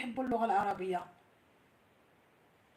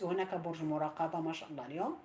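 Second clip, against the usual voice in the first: ordinary talk, nothing marked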